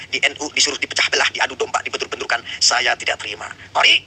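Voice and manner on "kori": loud, high shout of the name; sharp call-out. Real, not acted. Host shouting Kori's name as a warning. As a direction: call him out before a threat. Calling out rival with threat.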